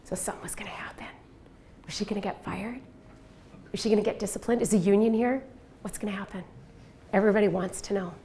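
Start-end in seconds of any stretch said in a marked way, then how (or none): 0.0-2.8 hushed suspenseful whisper. Real, not acted. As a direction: whisper it like a secret. Building suspense in a story.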